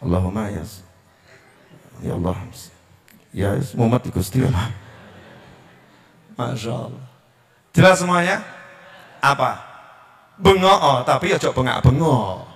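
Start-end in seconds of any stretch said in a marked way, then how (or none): 0.0-7.0 much softer and lower, listless; limp muttered prayer. Shown as a demonstration. Preacher mocking a dispirited prayer, faint and low. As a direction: sigh it out like a tired beggar. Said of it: "Ndonga kok karo sini-sini".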